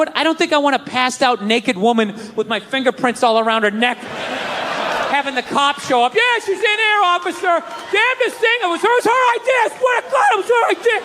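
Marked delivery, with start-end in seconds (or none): 6.1-11.0 high, pleading innocent voice; a guy swearing to an officer it was her idea; nervous guy to cop. Retold or imitated. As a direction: talk your way out with the police. Imagined talk to the cop.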